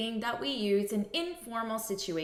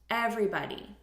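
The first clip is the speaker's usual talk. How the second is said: ordinary delivery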